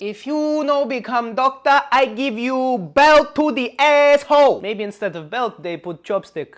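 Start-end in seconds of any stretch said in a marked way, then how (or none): 0.0-4.6 put-on slow, high, loud threat in Chinese-accented English; mock Chinese accent. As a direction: drag out a Chinese dad's warning, accent hammed up. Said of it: "Something like this".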